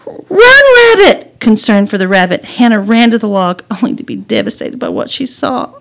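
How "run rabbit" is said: very high, loud shout; urgent warning cry. Acted. Girl warning rabbit of slingshot, urgent shout. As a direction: cry out to save it.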